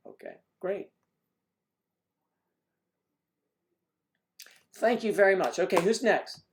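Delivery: mock casting director.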